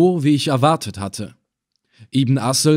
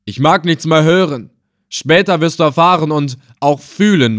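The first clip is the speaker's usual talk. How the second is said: loud, raised menacing refusal; thundering; 4 semitones higher, 6 dB louder than usual. A narrator's reading. Slave hunter threatening the old captive, thundering. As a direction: shut him down with a loud threat. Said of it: "donnerte ihn Ibn Assel an".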